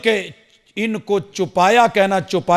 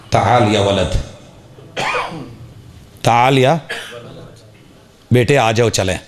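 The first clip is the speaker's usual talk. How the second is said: a hint of a father gently calling his son; loving father; 7 semitones lower than usual. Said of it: "بڑے پیار سے باپ نے بولا".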